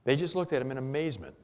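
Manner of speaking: ordinary talk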